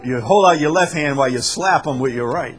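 ordinary talk

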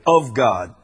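emphatic "of God", weight on "of"; stress on OF. Shown as a demonstration. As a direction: hit "of" firmly before "God". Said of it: "The emphasis is right here".

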